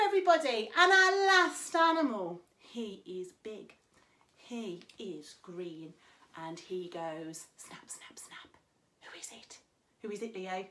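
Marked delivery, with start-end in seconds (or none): 2.7-7.9 soft, slow and mysterious; slow hushed clue. Acted. Teacher slowly hinting at the crocodile. 7.9-9.6 breathy whispered snap snap. Acted. Teacher whispering the riddle to children.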